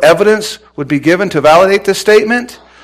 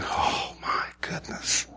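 awed whisper; 16 dB quieter, slower than usual. Acted. Acted awed reaction to being called.

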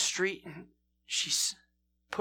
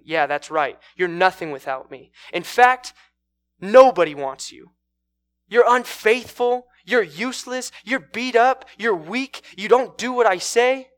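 acted contemptuous tirade; 5 semitones higher, 10 dB louder than usual. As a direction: hurl each insult as its own punch. Abuser tears the woman down.

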